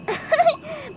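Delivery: squeaky little giggle; high cartoon giggle. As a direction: giggle helplessly in a pipsqueak voice. Giggles as SpongeBob.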